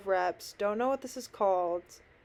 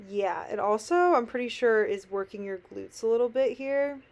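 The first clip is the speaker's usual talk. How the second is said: ordinary talk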